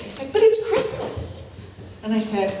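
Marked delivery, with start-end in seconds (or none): 0.3-1.5 much higher, slow, disbelieving; imitating the husband's shocked protest; aghast protest. Husband objecting to mashed potatoes at Christmas, shocked. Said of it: "he was aghast".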